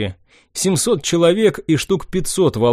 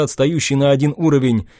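plain reading voice

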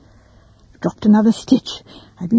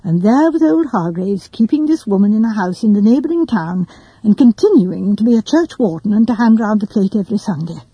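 ordinary delivery

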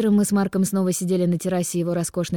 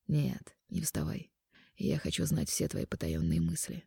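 soft, low man's voice; quiet intimate male lover; 3 semitones lower, 9 dB quieter, slower than usual. Acted. Mark speaks to heroine.

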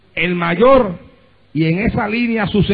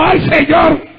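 tearful cry to God; 8 semitones higher, slower than usual. Retold or imitated. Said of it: "yo ahí arrodilladito llorando".

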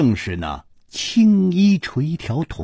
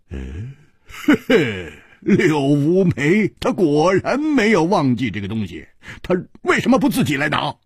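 cold sneer; 3 semitones higher than usual. Acted. Hu Tiehua scoffs at Liu Wumei's demand, cold sneer. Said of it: "胡铁花冷笑着说".

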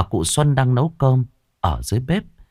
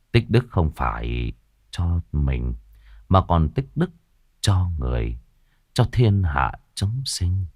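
slow, low, gentle teacher's voice; calm a startled disciple with wisdom; old monk's counsel; 6 semitones lower than usual. Acted. Master teaching his young disciple about virtue, slow and low.

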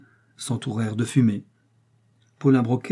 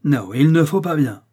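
violent objection; 4 semitones higher, 6 dB louder than usual. A narrator's reading. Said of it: "D'un geste violent".

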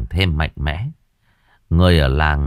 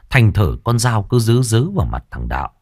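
ordinary delivery

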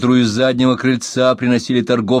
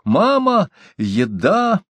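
put-on excited child call; 8 semitones higher, much slower than usual. A narrator's reading. Boy cries out to mother.

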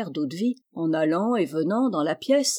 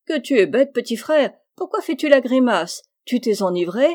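louder, mostly higher scolding questions; acted chiding; 4 semitones higher, 5 dB louder than usual. A narrator's reading. Captain to his grimacing orderly, chiding. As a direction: badger him with exclamations and questions.

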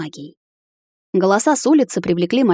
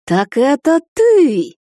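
hissed witch's accusation, louder and higher, slow; witch hissing; 8 semitones higher, 6 dB louder, much slower than usual. A narrator's reading. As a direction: spit the words at her enemy. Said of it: "зашипела ведьма".

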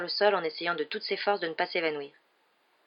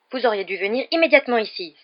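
raised, indignant scolding; louder, higher angry reproach; fuming; 4 semitones higher, 6 dB louder than usual. A narrator's reading. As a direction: scold with hard emphasis. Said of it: "fulmina-t-elle".

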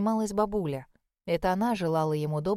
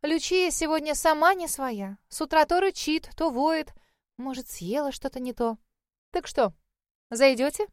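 put-on chatty older lady; 6 semitones higher than usual. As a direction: chatter on about the dog. Chatty hostess voice.

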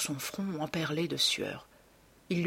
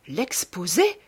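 stunned question rising louder and higher; alarmed exclamation; 5 semitones higher, 6 dB louder than usual. A narrator's reading. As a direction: exclaim in dawning dread. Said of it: "s'exclama Dorian Gray".

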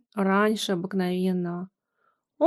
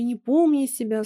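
usual speaking voice

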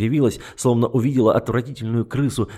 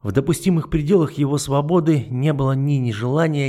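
ordinary delivery, nothing marked

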